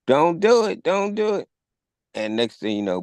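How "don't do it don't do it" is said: high-pitched imitated warning shout; mock yell. Officer yelling at the woman, high mock warning.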